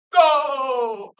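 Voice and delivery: high, loud, long-held announcer yell; mock goal call. Mocking soccer commentary, drawn-out goal shout. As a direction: stretch the word like a soccer announcer.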